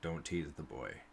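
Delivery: put-on gruff older man, low and soft; deep gruff warrior. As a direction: rumble it low and dismissive. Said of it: "kinda looks at him and goes".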